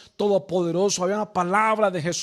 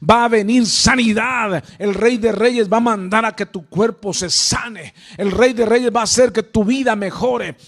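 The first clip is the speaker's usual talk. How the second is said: loud, urgent preacher's exhortation; fervent preaching; 9 dB louder than usual. Acted. Preacher urging the congregation to obey, loud and fervent.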